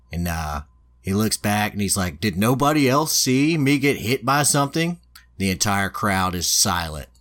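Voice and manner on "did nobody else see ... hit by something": higher-pitched, lightly acted disbelief; mock appeal to witnesses, pitched up; retold incredulity. Hit hero appealing to the silent crowd, incredulous.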